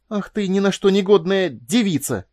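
high, loud scolding outburst; angry bark. A narrator's reading. Furious mistress turns on the hesitant young woman, angry bark. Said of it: "рявкнула Корделия".

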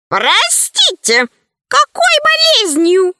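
high-pitched, odd character voice; puzzled old sage. A narrator's reading. Passing sage asks what illness is meant, high puzzled voice. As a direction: ask in a very high, put-on sage's voice.

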